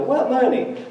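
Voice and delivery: high, baffled reply; confused innocent asking back; puzzled. Retold or imitated. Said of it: "And you go".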